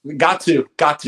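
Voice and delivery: loud, high insistence; emphatic. Real, not acted. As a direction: press the point hard. Emphatic agreement.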